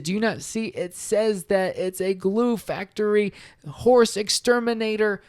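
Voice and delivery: panicked donkey reading the van's sign aloud; freaking out. Acted. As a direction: plead with the others to see it. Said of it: "he starts freaking out".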